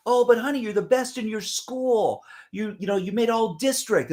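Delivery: high-pitched imitation of a doting parent; reassuring parent. Parent consoling a child about school ranking, high mock parent voice. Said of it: "parents are like going".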